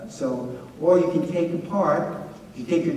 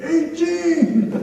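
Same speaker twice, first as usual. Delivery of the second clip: much higher, cheerful boast; proud drunk reply; 13 semitones higher, 4 dB louder than usual. Retold or imitated. Drunk student on spring break boasting his beer count. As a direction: answer like a proud wasted kid. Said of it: "He's like".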